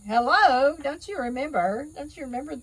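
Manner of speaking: high and loud, mock-incredulous hello; exaggerated hello. Real, not acted. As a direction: call out the reminder playfully, high-pitched. Teasing reminder to her audience.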